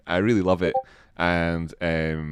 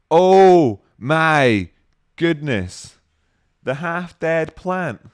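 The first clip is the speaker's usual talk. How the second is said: loud, higher oh my goodness; mock-shocked exclamation; 6 semitones higher, 8 dB louder, much slower than usual. Real, not acted. Streamer pretending shock at the half-dead plant jibe, loud and high. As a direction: gasp it out in mock horror.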